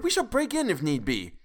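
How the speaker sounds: cried out. A narrator's reading. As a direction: blurt it out, high and rash. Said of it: "I cried".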